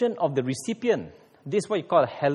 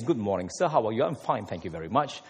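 put-on English accent; 8 semitones lower, 4 dB quieter, faster than usual. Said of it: "You meet an Englishman".

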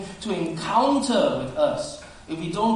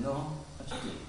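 soft, hushed correction; gentle correction; 12 dB quieter than usual. Acted. God answering Sarah's denial, gentle. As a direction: correct very softly, without reproach. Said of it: "But God comes back to her gently".

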